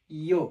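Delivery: ইয়ো as one loud syllable, "yo"; pronunciation demo. Shown as a demonstration. Teacher showing learners the next letter, demonstrating pronunciation. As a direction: model ইয়ো firmly on its own, "yo". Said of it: "এরপরের অক্ষরটির pronunciation হবে".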